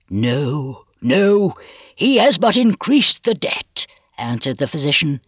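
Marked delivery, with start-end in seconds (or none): 0.1-3.9 fierce insistence, rising high and drawn out; mounting fierce refusal, voice climbing slowly. A narrator's reading. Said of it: "his manner lost its fiercer characteristics".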